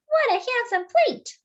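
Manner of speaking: high, bright-eyed donut voice; excited Arnie. Acted. Arnie the donut admiring his plate, high and delighted. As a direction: gush over the plate in a high voice.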